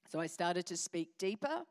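put-on low-pitched voice; deepened voice. Shown as a demonstration. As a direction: drop your voice deep and keep it there. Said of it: "continued to speak like this".

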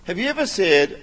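ordinary talk

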